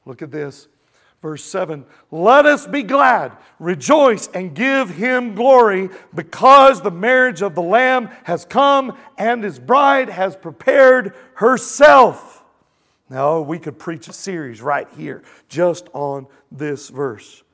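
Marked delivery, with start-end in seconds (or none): 2.1-12.4 loud, high, booming scripture reading; ringing, emphatic pulpit proclamation; full-force preaching. Real, not acted. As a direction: proclaim it to the whole crowd.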